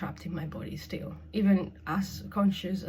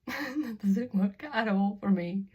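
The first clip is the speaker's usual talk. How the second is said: higher, slower, laughing through it; laughing admission; 4 semitones higher, slower than usual. Real, not acted. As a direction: repeat it with a rueful laugh. Laughs while repeating own admission.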